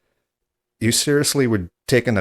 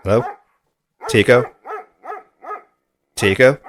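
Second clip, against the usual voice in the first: loud, higher-pitched call into the phone; raised voice, calling down the line; 5 semitones higher, 8 dB louder than usual. Acted. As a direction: call out the name to get someone's attention.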